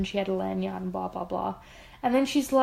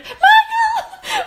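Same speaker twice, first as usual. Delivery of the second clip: much higher, loud laughing cry; laughing shout; 9 dB louder than usual. Retold or imitated. Retelling begging Michael to flee the surveyor, laughing shout. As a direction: yell for him to flee, half laughing. Retelling her escape plea.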